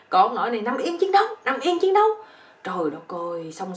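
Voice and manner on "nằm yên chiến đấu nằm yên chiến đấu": high, loud mock battle order; yelling. Old army teacher to his bedmate, a hammed-up combat yell. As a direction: yell it like a military command, hammed-up. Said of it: "ổng la lên".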